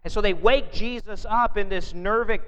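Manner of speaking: usual speaking voice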